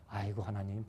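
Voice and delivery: low, hushed mock groan of objection; devil protesting. Devil answers God about Job again, low soft protest.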